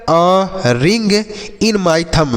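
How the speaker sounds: very slow, every word stretched; slow model sentence. Shown as a demonstration. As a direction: say it slowly so pupils can copy. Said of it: "जैसे आप बोल सकते हैं". (धीरे-धीरे, शब्द खींचकर)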